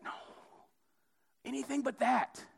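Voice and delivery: hammed-up plea to be spared; mock dismay. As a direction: wail the refusal in comic dismay. Acted horrified refusal.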